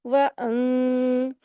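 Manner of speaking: "वअं" with a long hum through the nose; held ghunna. Shown as a demonstration. Teacher demonstrating the hidden noon, long nasal hum. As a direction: hold the end of "वअं" as a nasal tone. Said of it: "नून को गुन्ना करके".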